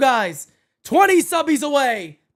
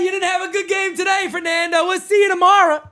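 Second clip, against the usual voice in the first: ordinary talk, nothing marked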